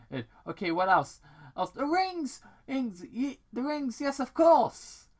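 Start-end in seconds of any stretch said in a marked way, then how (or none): 1.7-5.1 high, loud eureka cry, slowing at the end; excited realization. Acted. Sonic remembering his rings while packing, excited. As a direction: shout it like the answer just hit.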